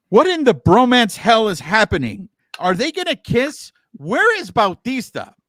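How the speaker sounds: hammed-up incredulous text reading; mock excited reenactment. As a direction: act out her bewildered text message. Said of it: "this is going to be a reenactment".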